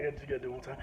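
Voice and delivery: normal talking voice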